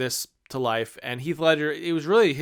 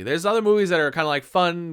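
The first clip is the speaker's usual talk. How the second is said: ordinary talk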